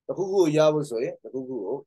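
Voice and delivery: ordinary talk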